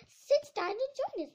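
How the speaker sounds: high, loud host's call; exuberant welcome. A narrator's reading. Mad Hatter inviting Alice to the party, exuberant.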